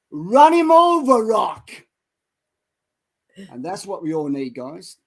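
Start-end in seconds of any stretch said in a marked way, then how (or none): 0.1-1.7 high, loud and slow battle cry to his fighter; trainer's yell. Retold or imitated. Trainer screaming at Rocky to charge, loud and high. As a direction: roar the order from the corner. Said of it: "But Mickey yells out".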